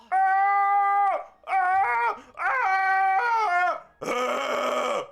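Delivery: high, loud hammed-up screams; over-the-top wounded yelling; mock pained cries. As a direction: yell as if hit. Fake pain after shooting.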